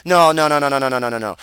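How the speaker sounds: ordinary talk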